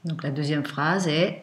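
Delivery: ordinary talk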